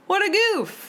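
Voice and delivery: bright mock-dismay; playful exclamation. Real, not acted. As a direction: tease the story's silliness. Exclaiming at the story.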